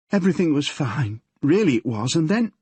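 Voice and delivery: put-on nervous girl confessing to her father; teenage daughter Yvonne.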